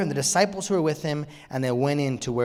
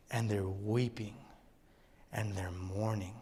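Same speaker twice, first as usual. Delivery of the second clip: soft, low and slowed for grief; hushed, sombre; 5 semitones lower, 10 dB quieter than usual. Real, not acted. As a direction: let the voice sink as if at a house of mourning. Pictures arriving at a mourning house.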